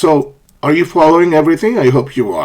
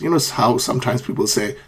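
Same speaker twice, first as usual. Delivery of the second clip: ordinary talk, nothing marked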